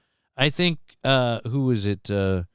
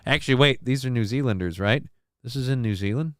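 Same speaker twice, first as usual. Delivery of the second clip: ordinary talk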